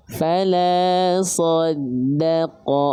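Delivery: fala saddaqa slowly chanted; Quran recitation. Shown as a demonstration. Teacher recites the verse for students. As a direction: draw out fala saddaqa in tajwid style. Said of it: "Muncungkan dulu".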